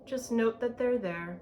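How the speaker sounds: ordinary delivery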